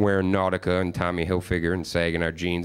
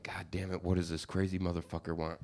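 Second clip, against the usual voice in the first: hushed, under the breath, much softer; muttered aside; 3 semitones lower, 12 dB quieter than usual. Retold or imitated. Cashier dreading the coworker walking in, muttering quietly. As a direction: mutter it to yourself at the counter. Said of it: "I'm like".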